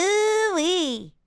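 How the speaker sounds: high, too-nasal cartoon ooh-wee; little baby voice. Shown as a demonstration. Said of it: "little baby voice".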